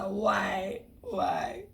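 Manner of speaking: high, hammed-up crying why; mock wail. Nigerian traveller protests transit visa rule, mock crying.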